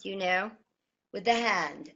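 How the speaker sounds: normal talking voice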